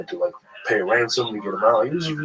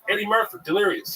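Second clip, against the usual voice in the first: higher, offhand naming of the classic; matter-of-fact comics; 10 semitones higher than usual. Retold or imitated. Other comics naming Eddie Murphy's special, offhand. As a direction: toss it off like everyone knows it. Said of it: "They're like".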